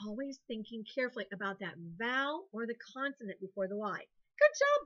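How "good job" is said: much higher, loud praise; high cheer. Real, not acted. Teacher cheering the boys and girls, high cheer. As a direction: cheer the whole class on.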